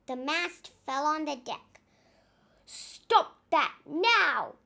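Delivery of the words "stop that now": loud, slow angry command; slow furious order; furious bellow. Acted. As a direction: roar at the hippo. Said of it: "the captain bellowed".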